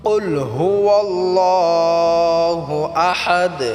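slow, melodic, drawn-out chanting; Quran recitation. Acted. Teacher reciting the surah for students to repeat, slow chant. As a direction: chant each verse slowly with long held vowels.

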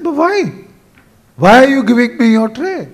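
loud, high-pitched questioning; bewildered protest. Retold or imitated. Traveller asks boy about the given tray, bewildered.

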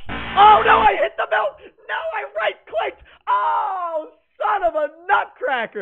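scared horror game streamer. Real, not acted. Misclick during chase.

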